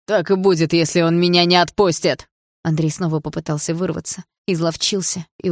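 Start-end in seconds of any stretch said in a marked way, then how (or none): 0.1-2.3 loud, helpless rage; angry yell. A narrator's reading. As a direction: shout threats from a powerless position. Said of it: "заорал отчим в злом бессилии". (орать в злом бессилии)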